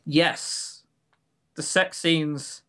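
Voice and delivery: normal talking voice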